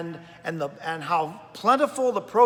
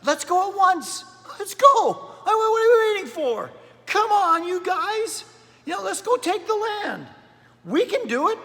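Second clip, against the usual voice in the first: eager Caleb; 11 semitones higher than usual. Retold or imitated. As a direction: fire up the doubters, let's go now. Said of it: "Caleb says".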